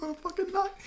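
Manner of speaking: squeaky high disbelief; incredulous. Retold or imitated. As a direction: pitch it way up in mock disbelief. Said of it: "I'm like".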